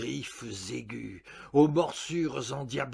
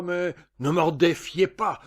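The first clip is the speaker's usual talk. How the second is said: loud, slightly raised warning; mild yell; 3 semitones higher, 7 dB louder than usual. A narrator's reading. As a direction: yell it as a dare, without full force. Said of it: "hurlai-je".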